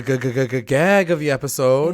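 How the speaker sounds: deliberate drumroll stutter before a reveal; mock-stuttered build-up to big news; playful stutter. Shown as a demonstration. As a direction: stammer the first sound for suspense. Teasing the episode's big reveal.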